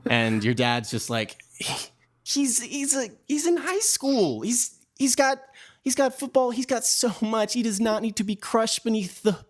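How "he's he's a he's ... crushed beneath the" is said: put-on much higher, strained father defending his boy; pleading dad. Dad pleading with grandfather to spare his son, high strained voice. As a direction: plead for your kid in a high, cracking voice.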